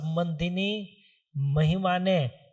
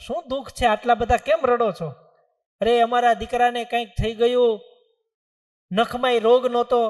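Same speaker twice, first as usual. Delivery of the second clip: anxious wailing; 5 semitones higher, 6 dB louder than usual. Acted. Saint asks, family wails.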